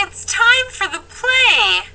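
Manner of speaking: ordinary talk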